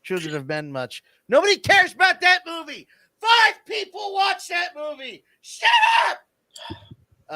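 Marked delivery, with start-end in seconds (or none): 1.3-7.3 louder and higher, shouting it down; mock rant shout. Shouting down fans of a movie nobody watched, loud and high. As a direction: yell it like you're shutting someone up. Ranting at an imagined movie fan.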